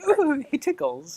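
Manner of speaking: very high, slow squeal, softly done; giggly, high-pitched delight; ticklish character. Acted. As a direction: play being tickled, in a little high voice. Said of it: "She's like".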